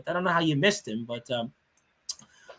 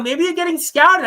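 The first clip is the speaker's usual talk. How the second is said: excited hype; 10 semitones higher, 7 dB louder than usual. Acted. Hyping a fan being scouted.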